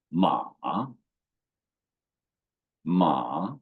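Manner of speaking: "Ma" dipping low, then rising; drawn-out "Ma" with a dip in the middle; third tone. Shown as a demonstration. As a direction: scoop "Ma" down and back up. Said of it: "falling first, then rising".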